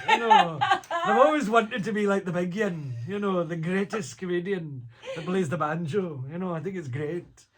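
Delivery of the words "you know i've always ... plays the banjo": laughing through the words, higher and louder. Real, not acted. Laughing as he tells the interviewer about the banjo. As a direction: giggle it out while talking.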